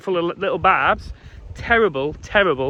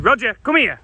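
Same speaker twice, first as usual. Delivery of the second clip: higher, louder call to a runaway dog; 9 semitones higher, 6 dB louder than usual. Real, not acted. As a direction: shout the dog's name across the field.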